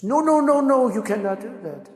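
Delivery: high, loud, hammed-up panicked refusal; protesting Macron. Macron refusing the price demand, high and loud mock protest. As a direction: play the French leader yelping no in alarm. Macron hears tariff threat.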